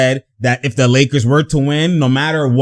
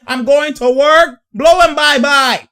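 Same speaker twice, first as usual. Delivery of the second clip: taunting the defender, high shouted hype; mock hype shout; 9 semitones higher than usual. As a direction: shout it like trash talk. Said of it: "He said".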